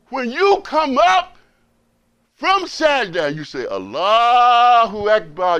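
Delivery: high, slow, loud build on Allahu Akbar; rising preaching. Acted. Preacher showing the brothers how to rise from Sajda, full force. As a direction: lift the congregation with each phrase.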